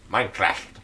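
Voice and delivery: light nerdy voice. Shown as a demonstration. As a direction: say it like a nerd. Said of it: "That was my nerdy voice impression".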